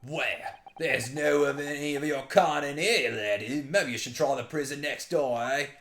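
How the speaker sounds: loud put-on Scottish governor; Scottish accent. Governor sending visitors to another prison, dismissive Scottish accent. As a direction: turn them away in mock Scots. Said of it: "he's very Scottish".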